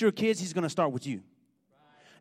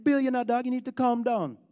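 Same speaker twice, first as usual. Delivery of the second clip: higher-pitched imitation of a Jamaican buddy; Jamaican accent; 5 semitones higher than usual. Preacher quoting his buddy's roommate, imitated Jamaican accent. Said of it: "his Jamaican roommate, he, he always talked like that".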